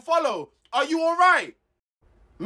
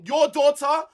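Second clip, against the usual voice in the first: usual speaking voice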